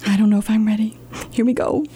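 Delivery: whispered nerves; nervous whisper. Acted. Librarian braces for silly sounds, whispered. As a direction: whisper it to the kids, worried.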